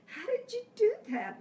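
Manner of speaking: imitating his amazed repeat, high and loud; insistent retold question.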